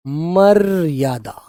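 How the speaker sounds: मर्यादा drawn out very slowly; slow dictation. Shown as a demonstration. Teacher stressing the word for students, slowly drawn out. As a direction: stretch मर्यादा for the class.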